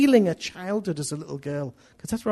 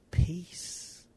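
soft, whispered word; hushed peace; 6 dB quieter than usual. Shown as a demonstration. Preacher promising peace after giving it to Jesus, whispered. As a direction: let it out in a whisper.

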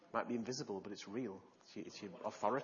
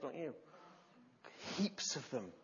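ordinary talk

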